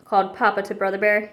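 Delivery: usual speaking voice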